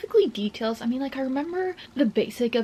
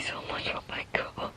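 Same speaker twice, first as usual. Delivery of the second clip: adoring whisper; 9 dB quieter than usual. Real, not acted. Whispered fan reaction.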